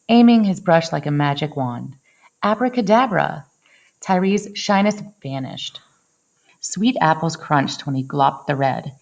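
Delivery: usual speaking voice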